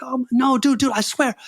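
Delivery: scared pleading. Retold or imitated. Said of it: "I'm getting scared now".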